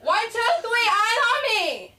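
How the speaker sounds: high, loud drawn-out chant; shouted singsong count, last words stretched; teacher calling out. Acted. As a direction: call a rowdy class to attention. Teacher's attention call, acted.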